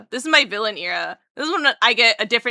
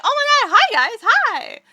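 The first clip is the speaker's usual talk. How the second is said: high, loud, gushing hello; overjoyed high-pitched greeting; happy greeting; 12 semitones higher, 6 dB louder than usual. Retold or imitated. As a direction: re-enact squealing at returning friends. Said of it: "I get to meet new people".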